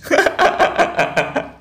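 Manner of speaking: a real very high, airy laugh. Real, not acted. Host laughing at Gus Ahong crying, high airy laugh. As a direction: squeal with amused laughter.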